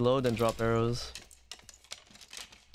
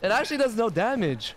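incredulous; 7 semitones higher than usual. Real, not acted. Repeats in disbelief.

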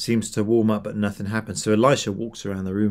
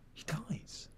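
hushed; 6 semitones higher, 21 dB quieter than usual. A narrator's reading. Hushed telling of death.

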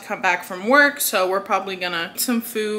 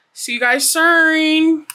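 slow, loud singsong goodbye; drawn-out sign-off; 6 semitones higher, 4 dB louder, much slower than usual. Real, not acted. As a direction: stretch out the goodbye.

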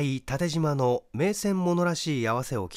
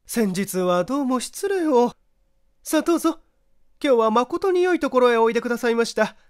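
much higher and louder, fast, eager hostess chatter; gushing neighbour wife; 10 semitones higher, 6 dB louder than usual. A narrator's reading. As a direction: gush over the guest like a talkative housewife. Chatty neighbour wife greets narrator. (甲高く大きな声で早口にまくしたてる噂好きの細君)

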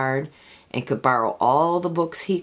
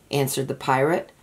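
ordinary delivery

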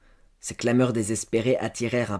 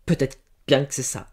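halting, higher, trembling admission; ashamed stammer; 4 semitones higher, 5 dB louder than usual. A narrator's reading. Servant girl half-admitting to her master, stammering with shame. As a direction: falter through it in shame. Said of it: "Elle balbutia, tremblant de honte".

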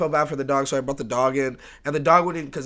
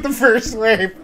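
higher-pitched, incredulous echo; raised-pitch echo of disbelief, retold; retold startled echo; 4 semitones higher, 3 dB louder, slower than usual. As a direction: repeat it back higher, as if startled. Teller's own startled echo of him.

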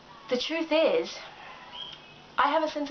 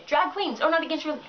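usual speaking voice

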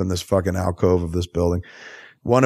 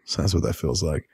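soft, low plain voice; as Bruce Wayne; 5 semitones lower, 6 dB quieter than usual. Shown as a demonstration. Showing how Bruce Wayne could say it, soft and low. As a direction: say it quietly like a normal man. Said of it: "why not just let him say it as Bruce Wayne".

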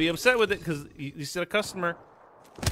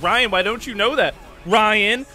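loud, raised needling question; higher, louder mock demand; imitated badgering customer; 4 semitones higher, 6 dB louder than usual. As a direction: mimic a pushy customer leaning on the name. Imitating customer badgering him by name.

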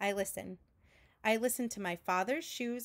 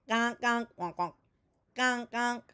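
a touch louder honking gonk; small duck honk; 3 dB louder than usual. A narrator's reading. Small ducks begging, lightly honked duck calls.

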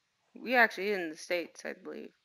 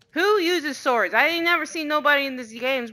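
mock outraged shout; 7 semitones higher, 8 dB louder than usual. Real, not acted. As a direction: yell it in disbelief. Repeats louder, mocking.

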